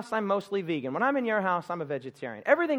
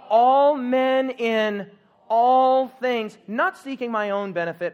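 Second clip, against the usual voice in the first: loud, slow, higher proclamation; loud enthusiastic; 7 semitones higher, 10 dB louder, much slower than usual. Shown as a demonstration. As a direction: shout it out with the congregation. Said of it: "not enough enthusiasm".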